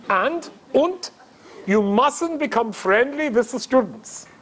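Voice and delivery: slow imitated German accent. German caller warns the rabbi about students, German accent. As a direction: lay down the rule as a German caller. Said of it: "in this German accent".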